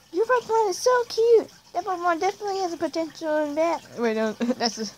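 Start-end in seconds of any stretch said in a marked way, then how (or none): 0.1-3.8 high-pitched game character voice. Acted. Player voicing a Pokemon game character's line, high character voice. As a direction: read it in a put-on, much higher voice. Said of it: "that's the wrong voice".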